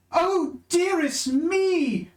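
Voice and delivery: high, very slow ogre; prissy ogre voice. Acted. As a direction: play a fussy lady ogre. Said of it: "and the ogre says".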